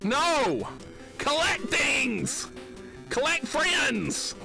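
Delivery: high, slow, drawn-out shouts; exasperated yelling. Real, not acted. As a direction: yell each phrase in mock outrage. Exasperated rant at the game.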